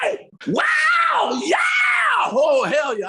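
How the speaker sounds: high, loud wailing shouts; rock scream. Retold or imitated. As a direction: let it rip like a soul singer. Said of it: "I was always screaming".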